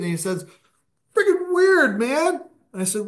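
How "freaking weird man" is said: high, loud dude voice imitating his shock; stunned brother. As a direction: play the rattled brother, pitched up. Brother just out of DMT trip.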